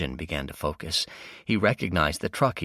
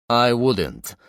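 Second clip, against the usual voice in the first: a hint of a heavy Russian accent, louder warning; heavily accented; 6 semitones higher, 7 dB louder than usual. Gunman warning the driver, lightly accented threat. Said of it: "said a heavily accented voice".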